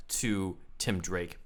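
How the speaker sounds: ordinary talk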